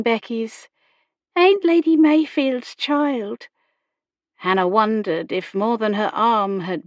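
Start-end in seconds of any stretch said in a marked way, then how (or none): 1.4-3.5 put-on high, slow girlish voice; young country girl, pitched well up; servant girl's blunder. A narrator's reading. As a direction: say it high, in rustic girl dialect. Remembered blunder in girl Becky's voice.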